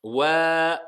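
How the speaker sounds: Wa held long and loud. Shown as a demonstration. Teacher showing students the lengthened vowel. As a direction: stretch the Wa out.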